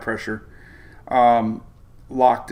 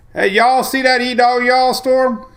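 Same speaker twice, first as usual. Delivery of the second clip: loud, high hillbilly holler; 12 semitones higher, 7 dB louder, slower than usual. Pretend. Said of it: "gonna go redneck".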